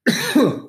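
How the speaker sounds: sudden choking cough; coughing fit. Real, not acted. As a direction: cough from a swallowed bug. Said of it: "I think I swallowed a fly".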